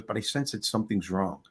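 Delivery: tactful, hushed concern; imitated gentle concern. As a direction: gently check on someone, low and soft. Stranger voices gentle concern.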